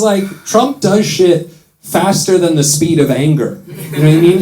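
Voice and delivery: ordinary talk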